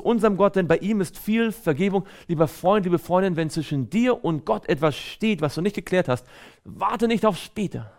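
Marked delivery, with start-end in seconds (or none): none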